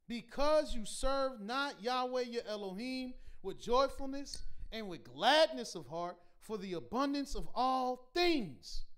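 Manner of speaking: ordinary delivery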